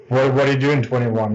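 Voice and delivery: ordinary talk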